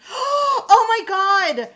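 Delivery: horrified gasp. Real, not acted. Fan recalling The Hobbit, gasping in loud disbelief. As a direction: gasp in disbelief and let it ring.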